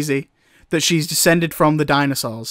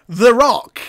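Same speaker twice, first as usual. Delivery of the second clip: louder, higher cartoon cry; mock shout; 6 semitones higher, 5 dB louder than usual. Mario remembering the rock, mock shout. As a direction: call it out like a hero on a mission. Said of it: "And Mario shouts".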